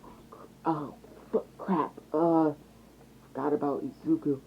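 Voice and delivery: quiet, halting oops; whisper. A narrator's reading. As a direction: murmur an awkward realisation. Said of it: "look at Bakugo and whisper".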